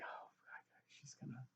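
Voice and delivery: hushed excited whisper to himself. Retold or imitated. Watching the Red Wedding beside his wife, whispering. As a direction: breathe it so wife can't hear.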